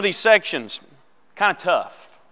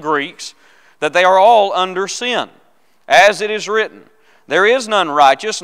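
scripture reading; 6 dB louder than usual. Real, not acted. Pastor reading Romans aloud to the class, loud and deliberate. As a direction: proclaim each verse firmly, phrase by phrase.

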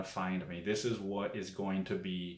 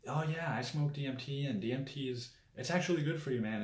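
higher-pitched, casual imitation; light, raised-pitch mock endorsement; Joe Rogan; 6 semitones higher, faster than usual. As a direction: toss it off like an enthusiastic podcaster. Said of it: "of Joe Rogan just being like".

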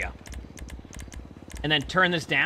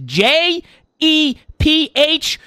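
very slow, higher letter-by-letter spelling; deliberate spelling; 8 semitones higher, much slower than usual. Pretend. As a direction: spell it out slowly, one letter at a time. Spelling his name out carefully.

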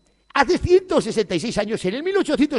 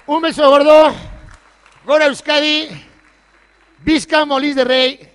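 loud, high, slow festive shouts; slow, loud shouts of Gora and Visca; closing cheers; 5 semitones higher, 9 dB louder than usual. Real, not acted. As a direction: rouse the crowd with each cheer.

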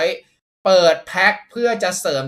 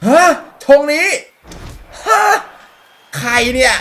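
high, loud puzzled exclaims; who is this, startled; real surprise at a strange card; surprised; 9 semitones higher, 9 dB louder than usual. Real, not acted. Unknown player appears.

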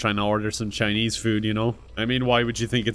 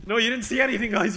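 laughing and fast; 10 semitones higher, faster than usual. Acted. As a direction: laugh through the words. Hides a crude picture.

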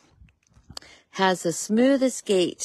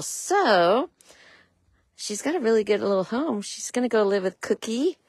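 ordinary talk, nothing marked

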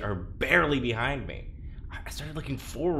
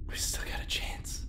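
whisper; 7 dB quieter than usual. Acted. Plotting an escape in secret.

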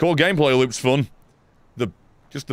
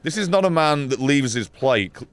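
ordinary delivery

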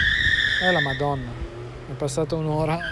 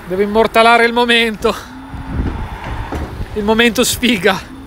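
excited; 5 semitones higher, 5 dB louder than usual. Real, not acted. Tow truck finally arrives.

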